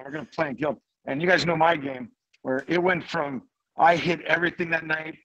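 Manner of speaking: ordinary delivery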